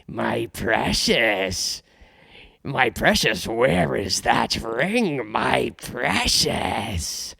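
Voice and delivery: slow, hissing Gollum creature voice, pleading over the ring; Gollum impression. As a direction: crawl through the words like Gollum. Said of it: "Smeagol slash Gollum".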